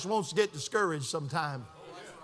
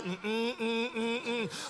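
very soft listening hums; sympathetic humming; 8 dB quieter, much slower than usual. Retold or imitated. Pastor listens to a man's troubles, soft hums. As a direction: hum quietly as if following along.

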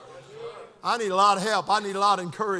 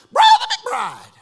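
very high, loud preacher's voicemail; phone message impression; 11 dB louder, slower than usual. Preacher mimics a friend's voicemail, loud exaggerated impression. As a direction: belt it out high and drawn out. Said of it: "tell it to you just like it was on the phone".

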